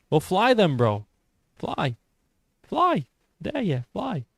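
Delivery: taunting, pitch leaping up high on short commands; goading dare. Pretend. Streamer daring a chatter to prove he can fly, goading. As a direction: egg them on with high, swooping jabs.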